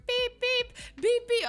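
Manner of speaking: high-pitched electronic beep mimicry; imitated alarm beeps. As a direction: beep like a detector going off.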